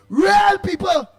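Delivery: fired-up outburst at the callers; shout. Real, not acted. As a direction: call it out loud and high. Heated call about politics.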